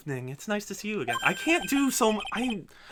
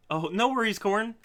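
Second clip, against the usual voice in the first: normal talking voice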